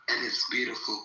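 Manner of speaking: ordinary talk, nothing marked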